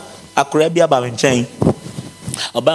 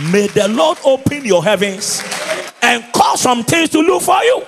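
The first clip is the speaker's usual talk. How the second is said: preaching blessing; 7 semitones higher than usual. Acted. Pastor blessing the congregation tonight, high shout. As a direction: lift the blessing up.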